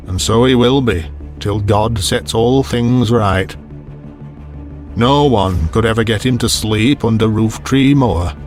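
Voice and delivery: ordinary delivery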